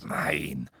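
low, reserved denial; guarded refusal; defensive. A narrator's reading. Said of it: "sagte er abwehrend".

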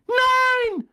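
very high, loud horrified scream; piercing drawn-out cry. A narrator's reading. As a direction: shriek it in horror. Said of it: "schrie Professor McGonagall".

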